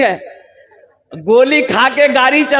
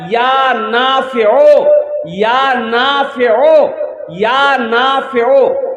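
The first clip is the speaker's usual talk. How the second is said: slow, measured phrase for repeating; slow dictation; much slower than usual. Shown as a demonstration. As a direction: teach it slowly, drawn out. Teaching the wazifa for repetition. (धीरे-धीरे सिखाते हुए)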